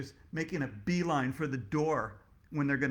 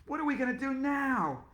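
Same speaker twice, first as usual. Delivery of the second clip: high panicky wail; panicked question; 9 semitones higher than usual. Acted. Teacher freaking out about refunds, high panicked question. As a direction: ask it in a panic.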